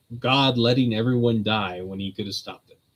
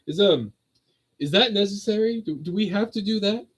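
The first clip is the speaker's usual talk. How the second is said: high-pitched posh fellow, politely hesitant; 9 semitones higher than usual. Retold or imitated. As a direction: imitate a posh gentleman timidly objecting. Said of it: "like, this posh".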